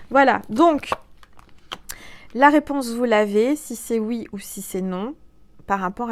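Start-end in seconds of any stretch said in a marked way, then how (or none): none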